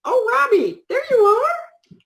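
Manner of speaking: high, drawn-out happy hello; delighted greeting. Real, not acted. Co-host spots a familiar viewer joining, delighted high-pitched greeting. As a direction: sing out a welcome, pitch way up.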